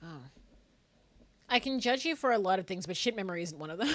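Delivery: normal talking voice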